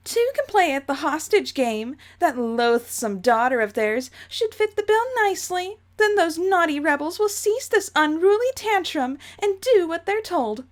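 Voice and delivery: affected ladylike menace, rising in pitch; Jane voice. Acted. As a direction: gloat like an Umbridge-like headmistress. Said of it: "my Jane voice is slowly turning into Dolores Umbridge".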